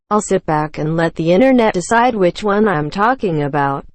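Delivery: ordinary talk, nothing marked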